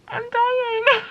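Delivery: high-pitched, near-tearful squeal; overwhelmed squeal. Real, not acted. Reader overcome by the book's romance, overwhelmed squeal. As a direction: squeal it, overcome, close to laughing.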